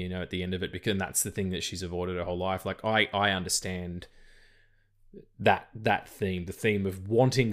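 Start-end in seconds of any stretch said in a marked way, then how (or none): none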